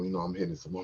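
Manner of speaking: ordinary talk, nothing marked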